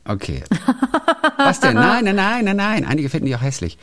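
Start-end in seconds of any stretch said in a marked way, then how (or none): 0.5-1.3 high bright laugh bursting out; burst of laughter. Real, not acted. Laughs at friend's teasing. 1.4-2.8 high, laughing denial; amused protest. Real, not acted.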